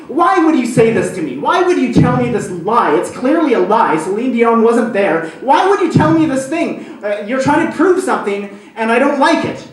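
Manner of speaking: wife blowing up at her husband over a lie; bursting out. Retold or imitated. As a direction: let the pent-up anger spill over. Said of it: "And she just bursts".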